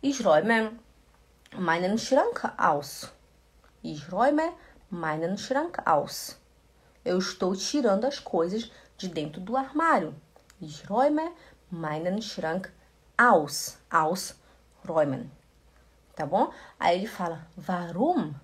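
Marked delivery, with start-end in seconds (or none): 0.0-6.4 slow, clear German question; careful slow teaching delivery; slow demo. Shown as a demonstration. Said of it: "A Daniela fala". 10.6-15.3 slow, clear German question; careful slow teaching delivery; slow demo. Shown as a demonstration.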